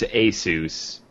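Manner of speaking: "Asus" said slowly as AY-soos; slow corrective "Asus", AY-soos; correcting pronunciation. Shown as a demonstration. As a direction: say "Asus" with a long AY, then soos. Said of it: "it's pronounced Asus".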